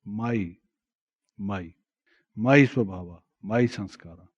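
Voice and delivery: "my" set apart and repeated, with pauses; stress on MY. Shown as a demonstration. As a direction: lean on the word "my" each time. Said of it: "The emphasis being on the word my".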